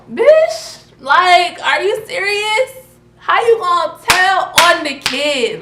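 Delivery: high, loud, exasperated outburst; furious high shout; outraged yell. Real, not acted. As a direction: yell it in disbelief at a snitching sister. Outraged at sister snitching.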